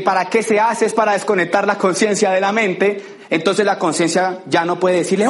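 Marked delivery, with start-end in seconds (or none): none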